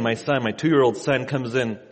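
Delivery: normal talking voice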